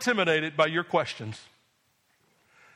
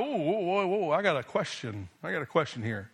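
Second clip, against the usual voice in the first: lower, softer acted aside; a quiet, puzzled interjection, lightly acted; mild hesitant doubter; 4 semitones lower, 5 dB quieter than usual. Bible reader stopping short at a doubt, mildly hesitant.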